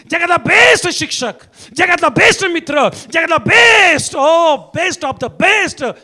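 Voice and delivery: high, stretched hits on each best; emphatic preaching. Real, not acted. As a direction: preach the list with mounting force. Preaching at full force. (जोरदार, लांबवलेला प्रवचनाचा सूर)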